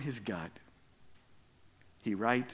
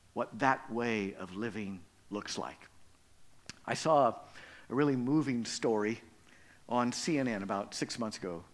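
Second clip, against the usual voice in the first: ordinary talk, nothing marked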